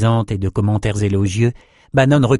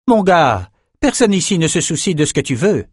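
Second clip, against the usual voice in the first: higher-pitched, curt put-down thrown at him; called-out retort; 7 semitones higher than usual. A narrator's reading. Adessa rebuffs a reluctant young fighter, called-out retort. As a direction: toss it out high, scornfully dismissing his wishes. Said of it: "lança Adessa".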